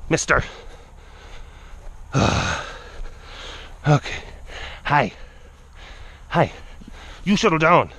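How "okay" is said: ordinary delivery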